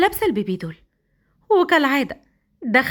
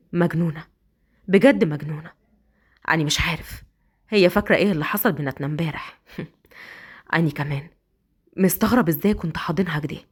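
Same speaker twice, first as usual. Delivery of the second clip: low, amused murmur to himself; husband musing; 7 semitones lower than usual. Acted. (أسد بيكلم نفسه بصوت واطي)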